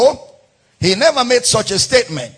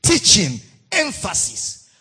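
usual speaking voice

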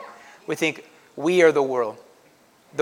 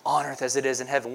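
softly repeated prayer; quiet prayer; 3 semitones lower, 6 dB quieter than usual. Shown as a demonstration. Pastor modelling a prayer at Walmart, hushed. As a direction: murmur it like a whispered refrain. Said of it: "You'll say, Okay, Lord".

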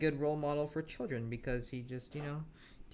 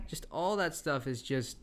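normal talking voice

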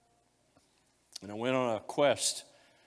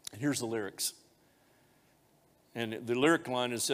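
normal talking voice

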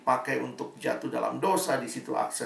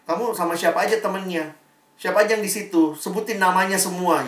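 suspicious interrogation; 6 semitones higher, 5 dB louder, faster than usual. Retold or imitated. Girl interrogating boyfriend.

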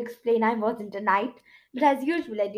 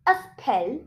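ordinary delivery, nothing marked

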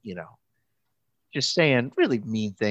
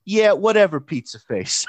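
sassy comeback; 7 semitones higher, 5 dB louder than usual. Retold or imitated. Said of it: "interrupts her and says".

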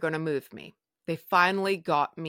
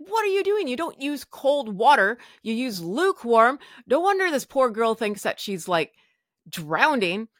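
raised, higher-pitched scolding; loud retold telling-off, starting very high; imitated nurse yelling; 5 semitones higher, 4 dB louder than usual. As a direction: scold the staff as an exasperated head nurse. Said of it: "She's yelling at everyone".

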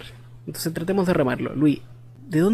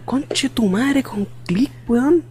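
hammed-up amazement at a discovery; mock excited exclamation; 7 semitones higher, much slower than usual. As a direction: imitate an over-excited friend, pitched high. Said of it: "estaba tan emocionado".

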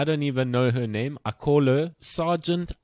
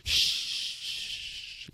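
a sharp unvoiced shh; hushing shh; 8 dB quieter than usual. Teacher hushing the students with a long shh. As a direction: hush the whole class at once. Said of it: "And she goes".

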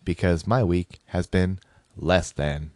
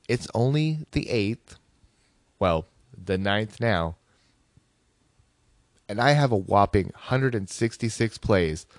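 ordinary talk

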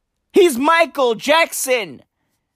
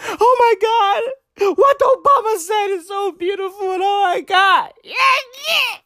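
mocking a crying fan; 10 semitones higher than usual. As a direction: ham up a weeping superfan. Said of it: "crying and shit on the timeline".

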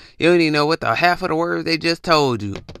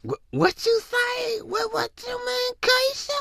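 very high, slow, squeaky disbelief; baffled outrage; 4 dB quieter than usual. Acted. Texting Keisha back, high squeaky baffled outrage. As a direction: squeal it in stunned confusion.

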